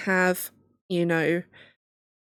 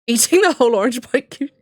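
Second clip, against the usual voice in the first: laughing; 5 semitones higher, 6 dB louder, faster than usual. Real, not acted. Laughing at own joke.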